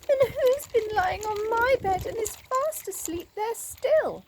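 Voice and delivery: high squeaky little bear voice; baby bear. A narrator's reading. Baby bear finding someone in his cot, high voiced. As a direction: pitch it way up like a tiny cub. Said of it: "said the baby bear".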